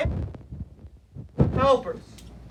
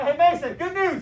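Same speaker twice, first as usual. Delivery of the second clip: excited news; 6 semitones higher, 7 dB louder than usual. Retold or imitated. Calling Mason with big news.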